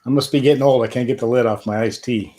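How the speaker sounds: ordinary talk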